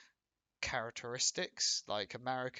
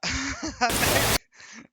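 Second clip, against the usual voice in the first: loud laugh; 12 semitones higher, 14 dB louder than usual. Real, not acted. Laugh after joke.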